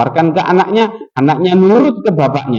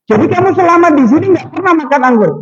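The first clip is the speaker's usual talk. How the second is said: astonished boss; 12 semitones higher, faster than usual. Retold or imitated. Boss shocked worker never tasted.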